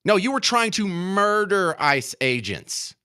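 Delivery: ordinary talk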